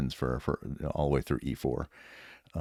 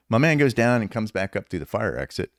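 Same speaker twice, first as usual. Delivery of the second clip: ordinary talk, nothing marked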